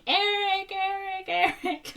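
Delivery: high-pitched mock scream of a name; imitated screaming. Retelling movie characters screaming for a missing boy, mock screaming. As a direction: call the name in a squealed pretend scream. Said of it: "they're, like, screaming".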